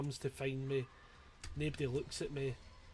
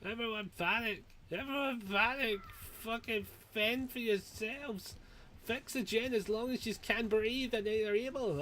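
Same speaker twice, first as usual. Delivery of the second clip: mock panic call; 8 semitones higher than usual. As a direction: bellow it like a panicking general. Mock order to the team.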